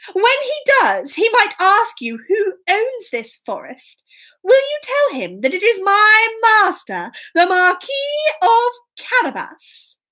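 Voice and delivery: high, emphatic coaxing; persuasive Puss. Acted. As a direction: instruct the woodcutters with a lilting request. Puss coaches the woodcutters.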